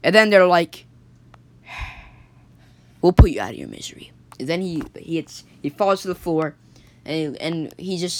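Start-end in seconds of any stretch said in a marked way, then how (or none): 3.0-4.1 much lower and fast, a dark threat; menacing gang voice. Acted. The gang tells Jonathan they'll end him, low and fast. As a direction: growl it quickly like a villain.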